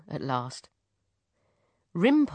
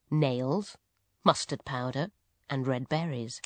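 ordinary delivery

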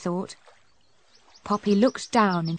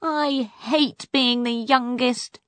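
usual speaking voice

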